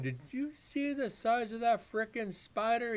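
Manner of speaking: high, incredulous retold exclamation; shocked outburst. Telling the boss about the giant spider, incredulous and high. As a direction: replay the disbelief of seeing a huge spider. Retelling his own shocked reaction.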